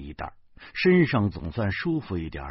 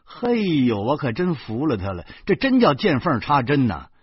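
usual speaking voice

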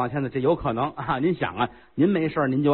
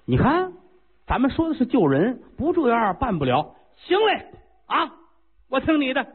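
put-on high, fiery young voice; a hot-headed prince vowing to act; eager young prince; 9 semitones higher than usual. Prince agrees to the plan.

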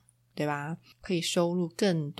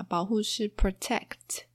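ordinary talk

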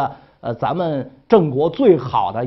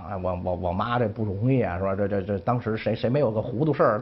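inner thought; 6 semitones lower, 6 dB quieter than usual. Acted. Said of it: "他还是想".

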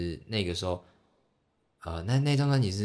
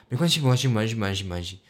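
higher, louder flustered reassurance that trails off; overly polite fan; 3 semitones higher, 6 dB louder, faster than usual. Retold or imitated. Fan reassures idol after the scribble, flustered and overpolite. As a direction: gush it's-fine over and over. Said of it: "我就是一个极度客气的人".

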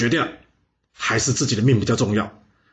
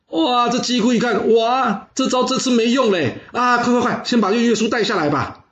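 high, panicky exclaiming; flustered alarm; 9 semitones higher than usual. Acted. Ruler seeing his hostage ploy fail, flustered. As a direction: react in comic dismay, pitched way up.

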